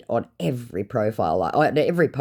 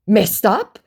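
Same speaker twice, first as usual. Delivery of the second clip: high, loud query; mock-incredulous scammer voice; 10 semitones higher, 8 dB louder than usual. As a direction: echo it as a baffled scammer. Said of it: "he said".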